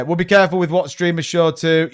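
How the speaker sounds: ordinary talk, nothing marked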